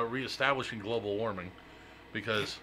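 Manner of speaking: ordinary delivery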